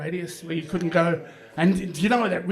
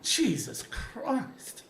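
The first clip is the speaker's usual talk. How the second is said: drawn-out, very slow, softer mock despair; slow dismayed aside; 4 semitones higher, 4 dB quieter, much slower than usual. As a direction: let it crawl out in slow dismay. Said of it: "I've gone".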